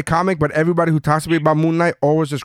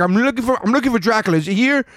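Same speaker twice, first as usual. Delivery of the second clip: higher, earnest Moon Knight asking; mock Moon Knight; 6 semitones higher than usual. Moon Knight asking the Avengers for Dracula, high earnest. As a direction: ask innocently, pitched up. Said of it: "And he's like".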